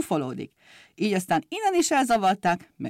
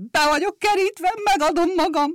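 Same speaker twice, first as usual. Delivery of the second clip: high, louder panicked cry; frightened cry; 10 semitones higher, 4 dB louder than usual. Acted. The driver, terrified, surrenders to the police whistles. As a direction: yell in terror, arms up. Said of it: "rémülten felkiáltott".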